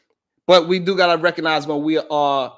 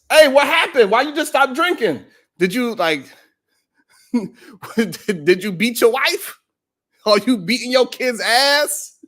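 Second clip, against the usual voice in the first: mock shocked buddy asking; incredulous friends; 7 semitones higher than usual. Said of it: "They used to ask me crazy questions".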